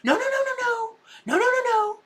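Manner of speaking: high, frantic string of no's; hammed-up high-pitched panic; panicked pleading. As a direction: beg them not to leave. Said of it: "And they'll go".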